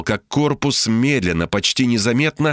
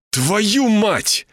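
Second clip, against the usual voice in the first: higher-pitched frustrated swearing; angry curse; 10 semitones higher than usual. A narrator's reading. Curses while kicking the window. (злое ругательство на повышенном тоне)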